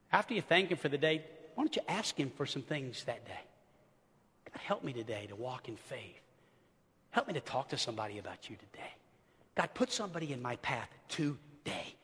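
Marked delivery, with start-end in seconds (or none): 4.5-12.0 low, soft, sincere petition; quiet prayer. Shown as a demonstration. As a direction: pray softly and simply. Models a short sincere prayer.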